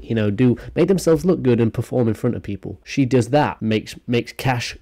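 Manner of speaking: usual speaking voice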